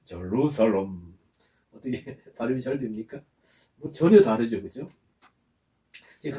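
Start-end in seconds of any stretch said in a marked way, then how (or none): 0.0-1.1 제루살렘 slow and lower, as "juh-ROO-sa-lom"; English pronunciation. Shown as a demonstration. Preacher has a deacon repeat it, 제루살렘 slowly as "juh-ROO-sa-lom". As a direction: model 제루살렘 for repeating, stretched "roo", falling end. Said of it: "한번 따라해보세요".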